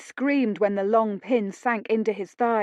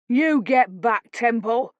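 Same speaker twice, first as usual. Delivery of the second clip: shouted; 3 dB louder, slower than usual. Acted. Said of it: "Tom shouted".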